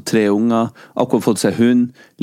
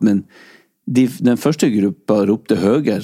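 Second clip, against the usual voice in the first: usual speaking voice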